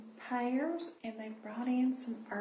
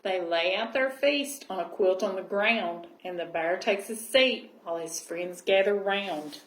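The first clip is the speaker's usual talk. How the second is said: gentle storybook reading voice; cosy read-aloud storytelling; reading to children; 4 semitones lower, 4 dB louder than usual. Acted. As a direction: read it like a bedtime picture book. Reading picture book aloud.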